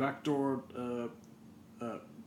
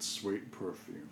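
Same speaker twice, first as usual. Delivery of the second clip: ordinary talk, nothing marked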